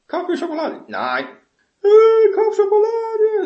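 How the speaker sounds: put-on child nagging mum for chocolate, from whine up to a loud howl; whining child. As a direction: nag for chocolate, building to a wailing howl. Child nags, escalating after refusals.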